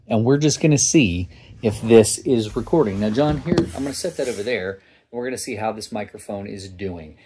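usual speaking voice

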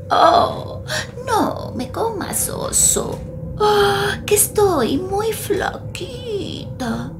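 put-on high, soft old lady begging; pleading grandma. Grandma pleading with the bear, high and soft.

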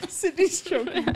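laughing through the words. Real, not acted. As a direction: giggle while you talk. Hosts cracking up.

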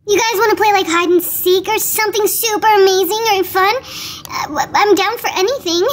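usual speaking voice

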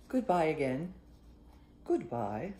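ordinary delivery, nothing marked

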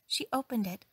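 ordinary delivery

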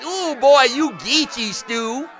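high, drawn-out mock ribbing; playful mocking imitation, slowing at the end; imitated teasing. Imitating college friends teasing him.

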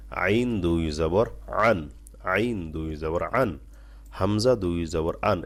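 slow, drawn out with throat pressure; slow careful tajweed drill; slow pressed recitation. Shown as a demonstration. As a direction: press and draw each syllable. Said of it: "একটু চাপ দিয়ে বলবেন".

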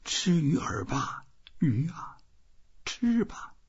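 breathy pleading whisper, slowing at the end; coaxing whisper. A narrator's reading. Old man silently coaxing the fish to bite, whispered. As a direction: urge the fish gently, under the breath. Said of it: "他想".

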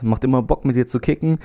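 usual speaking voice

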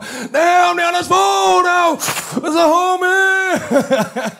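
very high, loud, the last words stretched slowly; hyped homie yell. Retold or imitated. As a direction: shout it out the car window like a gangster. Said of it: "Så kommer [name] og [name] op".

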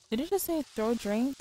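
ordinary delivery

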